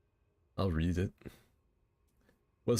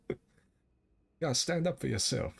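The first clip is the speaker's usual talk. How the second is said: slow, higher southern drawl; preachy rooster voice; southern blowhard; 6 semitones higher than usual. Acted. Blustering southern rooster bit.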